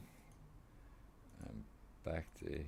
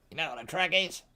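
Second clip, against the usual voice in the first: mimicking the lady; 9 semitones higher, 6 dB louder, faster than usual. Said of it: "Evidence you're not alone".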